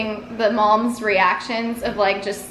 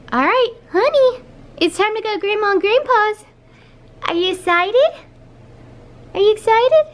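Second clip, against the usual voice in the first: high, sing-song puppy talk; dog-talk voice; 10 semitones higher than usual. Real, not acted. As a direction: coo at the dog excitedly. Talking to her dog.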